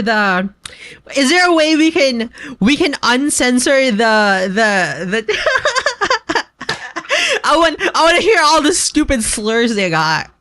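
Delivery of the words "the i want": usual speaking voice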